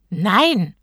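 loud, exasperated no; irritated. A narrator's reading. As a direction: reject the silly question. Irritated genie answers a silly question. (genervt und laut)

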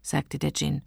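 plain reading voice

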